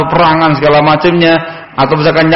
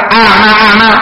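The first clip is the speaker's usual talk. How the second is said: higher, disapproving echo; imitated displeasure; 5 semitones higher than usual. The Prophet echoing Jabir's answer at the door, imitated displeasure. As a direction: repeat it back as if disliking the answer. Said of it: "seolah beliau tidak menyukainya".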